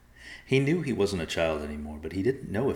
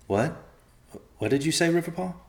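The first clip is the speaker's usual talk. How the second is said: sudden high startled question; startled, groggy, calling out to his cousin; startled waking; 11 semitones higher, 3 dB louder than usual. Acted. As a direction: jolt awake and ask sharply.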